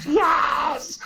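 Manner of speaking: high, gleeful mock schoolboy "Yes!"; a schoolboy thrilled at the news; schoolboy cheer. Imitating a schoolboy cheering over the expo, lightly done. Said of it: "the schoolboy just goes".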